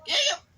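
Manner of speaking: high, loud squeaky hiccup. Acted. Piglet hiccuping while asking to help.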